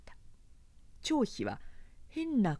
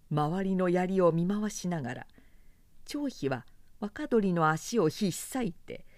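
plain reading voice

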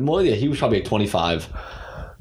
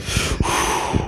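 heavy voiceless sigh; long exhale. Shown as a demonstration. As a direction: let out a long, effortful breath. Acts out straining to stay silent.

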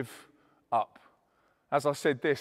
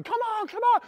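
high, urging squeal across the room; high voice, egging someone on; high-pitched coaxing; faster than usual. Retold or imitated. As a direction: urge her on in a very high voice. Coaxing her to dance from stage.

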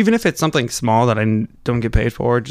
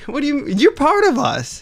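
mock outrage; 14 semitones higher than usual. Real, not acted. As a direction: voice jumps up high in mock indignation. Co-host disowns his own state.

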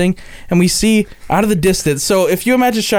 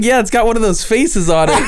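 laughing through the words; 6 semitones higher than usual. Real, not acted. Laughing while quoting his friend.

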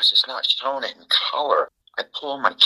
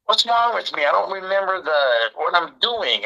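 retold frustration, pitched well up; frustrated; 7 semitones higher than usual. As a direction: imitate a frustrated man questioning himself, higher voice. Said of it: "We start getting frustrated".

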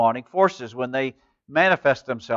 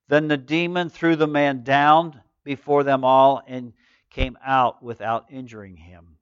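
ordinary talk, nothing marked

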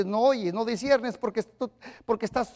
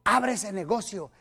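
quiet; 5 semitones lower, 6 dB quieter than usual. Retold or imitated. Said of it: "Dios te dice".

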